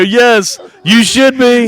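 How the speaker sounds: mimicked TV catchphrase. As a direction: lightly imitate the prank-show payoff line. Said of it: "And they would go".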